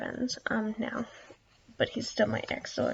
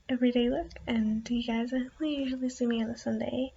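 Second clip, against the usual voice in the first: ordinary talk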